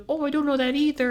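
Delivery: put-on high old lady voice; a helpless old lady, pitched well up; old lady customer. Old lady admits to the worker she's unsure, high voice.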